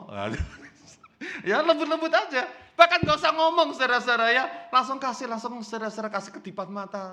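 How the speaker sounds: normal talking voice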